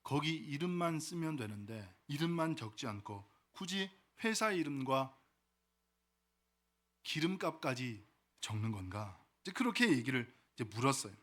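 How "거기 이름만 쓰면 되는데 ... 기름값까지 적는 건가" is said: lightly voiced older gentleman, soft and low; softly puzzled old gentleman, lightly acted. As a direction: ask quietly, with a hint of an elderly man. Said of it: "이 노신사가 물었습니다".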